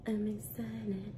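soft slow deadpan repeat; deadpan quiet. Shown as a demonstration. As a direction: say it hushed and slow.

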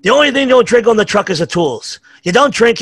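mock crew boss bark. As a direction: boom it like the boss on the truck. Irish coworkers telling him off.

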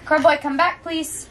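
ordinary talk

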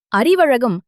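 plain reading voice

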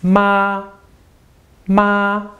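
MA held high and steady; high level tone on MA; first tone. Shown as a demonstration. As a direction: keep each MA on one high, straight line. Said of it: "your voice is high and steady".

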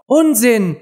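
high, decisive retort; loud and firm. A narrator's reading. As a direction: cut in firmly, pitched well up. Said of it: "sagte Alice sehr laut und bestimmt". (laut und bestimmt)

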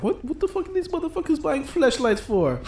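high-pitched, baffled Caribbean teacher; Caribbean accent. Retold or imitated. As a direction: imitate a dumbfounded man in a rough-and-ready Caribbean accent. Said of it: "was that a good Caribbean accent".

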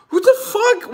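high stunned outburst; shocked disbelief. Retold or imitated. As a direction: squeak out the disbelief. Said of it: "I was like".

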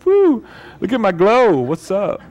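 high mock boasting for a selfie; hammed-up, high-pitched "woo" of self-admiration; selfie show-off. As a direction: show off like you're posting a selfie. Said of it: "we would be taking selfies".

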